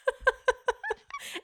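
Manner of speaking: squealing laugh, rising; high burst of laughter. Real, not acted. Laughing at Lauren.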